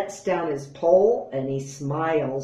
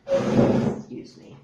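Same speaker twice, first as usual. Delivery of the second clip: strained; 6 dB louder than usual. Real, not acted. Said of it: "my chair needed readjusting".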